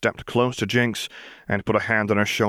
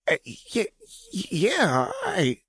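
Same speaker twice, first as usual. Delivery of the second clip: much higher broken stutter; confused stammer. Acted. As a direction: stumble over the words.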